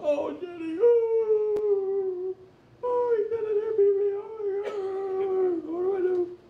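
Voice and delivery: very high, loud wailing imitation; hysterical crying call. Preacher imitating a beaten woman calling Jenny in a high wail.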